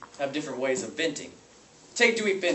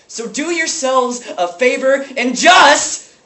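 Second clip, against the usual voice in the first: high, loud, drawn-out exasperation; raging rant; 11 semitones higher, 11 dB louder, slower than usual. Acted. Substitute teacher urging children to quit, raging rant. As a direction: push it loud and high toward a final verdict. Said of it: "lashing out his rage".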